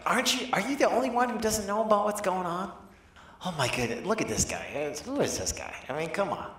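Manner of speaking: incredulous scolding, starting high-pitched; mock scolding. Disciples scold the stranger for not knowing, mock scolding. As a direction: scold him in comic disbelief. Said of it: "they start to scold him".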